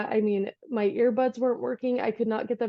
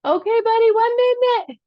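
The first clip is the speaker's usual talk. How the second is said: real parental holler, pitched way up; calling out; 12 semitones higher, 8 dB louder than usual. Real, not acted. Calling to toddler outside the door.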